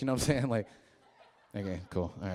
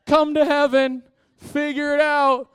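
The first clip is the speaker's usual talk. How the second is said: high, loud, drawn-out mock shout; mock careless God; 13 semitones higher, 10 dB louder, slower than usual. Pastor mocking a careless God, loud high mock call. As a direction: lampoon a God who shrugs off his people. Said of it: "He wasn't like".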